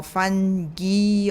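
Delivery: fungi with a hard g and final "ee"; fungi as fun-ghee. Shown as a demonstration. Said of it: "got various pronunciations".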